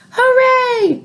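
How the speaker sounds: joyful shout of hooray; excited shout. A narrator's reading. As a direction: cheer it out like a thrilled boy. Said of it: "shouted George".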